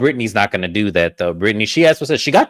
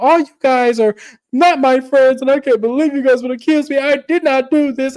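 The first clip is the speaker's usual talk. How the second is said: high-pitched mock wail; hammed-up hysterical denial; yelling; 12 semitones higher than usual. Lisa tells friends she is innocent, yelling. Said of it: "the fact that she's yelling".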